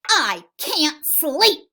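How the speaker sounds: slow, high, loud complaint; farmer's yell. Acted. Farmer kept awake by the animals, slow loud yell. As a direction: yell it word by word. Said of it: "yelled the farmer".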